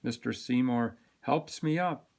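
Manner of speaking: usual speaking voice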